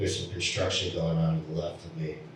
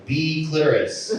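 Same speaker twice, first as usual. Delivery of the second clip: higher, louder calling out; lightly voiced bingo caller; 7 semitones higher, 5 dB louder than usual. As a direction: call it slowly to the hall. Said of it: "some guy in the front going".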